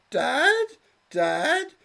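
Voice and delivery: very high, loud pleading daughter calling Dad; high girlish plea; squeaky daughter. Acted. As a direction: whine it up like a lovesick girl.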